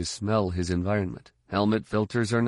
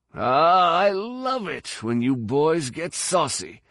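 high, drawn-out wheezy relish; wheezing fighter; 8 semitones higher, slower than usual. A narrator's reading. Fighter taunting the troopers who just shot him, wheezing. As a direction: wheeze it out with slow, high glee. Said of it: "he wheezed".

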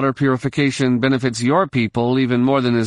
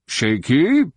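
higher, sharp demanding echo; 4 semitones higher than usual. A narrator's reading. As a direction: snap the word back as a challenge. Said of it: "demanded Kala".

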